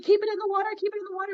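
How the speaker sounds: high-pitched urgent coaching; freaking out. Retold or imitated. Coaching a teammate fighting a fish, freaking out. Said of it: "I'm, like, freaking out".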